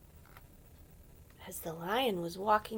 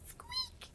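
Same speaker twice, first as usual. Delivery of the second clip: tiny high squeak; mouse squeak; 5 dB quieter than usual. Pretend. Mouse caught by lion.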